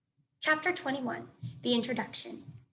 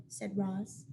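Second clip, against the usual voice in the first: ordinary delivery, nothing marked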